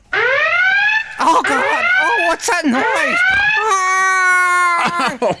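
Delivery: very high frightened shout; startled high cry. Real, not acted. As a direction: yelp at a sudden noise. Startled by a strange noise.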